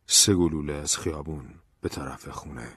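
plain reading voice

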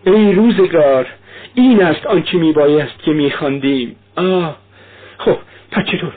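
high, feverish raving, words tumbling out; a mother in fever calling out to her children; voiced high and breathless with fever; delirious dying woman. A narrator's reading. Delirious Katerina tries to recall.

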